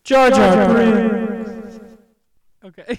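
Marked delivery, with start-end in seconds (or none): 0.0-1.8 very loud, long drawn-out shout with echo; echoing announcer shout. Pretend. Host redoes the failed bit with effect on, booming echoed shout. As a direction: bellow the name and let it ring out.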